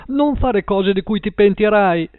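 high-pitched pleading warning; alarmed warning. A narrator's reading. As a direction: beg her not to do it. Man pleading with armed woman. (avvertimento implorante, voce acuta)

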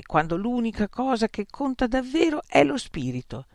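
usual speaking voice

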